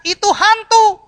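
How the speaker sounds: high, loud panicked cry of ghost; terrified scream. Acted. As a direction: shriek it in fear. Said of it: "makanya dia teriak". (teriakan ketakutan murid)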